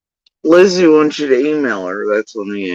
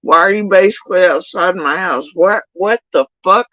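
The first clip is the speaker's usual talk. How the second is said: retold outrage; 9 semitones higher than usual. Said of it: "And I'm like".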